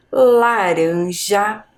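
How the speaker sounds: laranja very slow, with a lightly tapped R; drawn-out model pronunciation of laranja; weak R. Shown as a demonstration. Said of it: "O R tá fraco".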